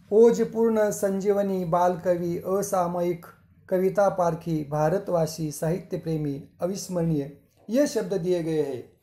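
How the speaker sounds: normal talking voice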